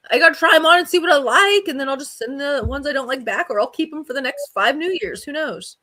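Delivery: chirpy, cheerful justification; excited. Retold or imitated. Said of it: "her answer to Lane".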